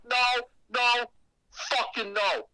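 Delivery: loud, high repeated no; adamant refusal. Retold or imitated. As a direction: shut it down hard, panicked.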